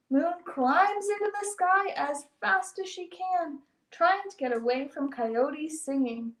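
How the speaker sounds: ordinary delivery, nothing marked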